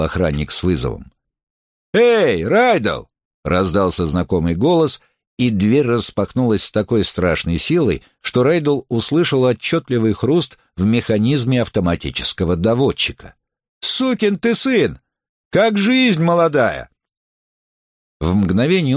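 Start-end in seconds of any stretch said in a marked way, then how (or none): 1.9-3.1 окрик. Acted. Знакомый голос окликает Райдела. 13.8-16.9 пьяное приветствие. Acted. Пьяный приятель шумно приветствует.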